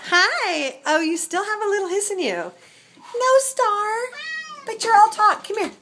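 high-pitched pet talk. Real, not acted. Talking to a hissing kitten, high baby talk. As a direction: coo high at a feisty little animal.

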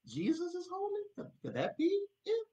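awed wonder. Acted. Preacher voicing a believer in high-pitched awe. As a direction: question leaps up very high.

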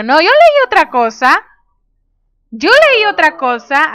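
loud, high correction; flustered protest. Real, not acted. Protests at what they misread.

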